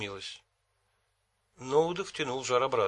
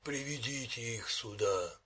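interested monster voice; 8 dB quieter than usual. A narrator's reading. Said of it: "В голосе Ноуды послышался интерес".

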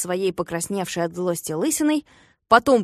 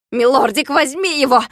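loud, higher, straining call to the dog; straining yell; 5 semitones higher, 5 dB louder than usual. A narrator's reading. Klops straining to urge his dog, loud yell. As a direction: yell yourself hoarse at the dog. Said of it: "надрывался Клопс".

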